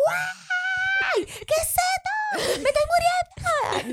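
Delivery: high shrieking cry of pain; panicked scream. Retold or imitated. Mother in labor screaming she is dying. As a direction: scream like you think you're dying.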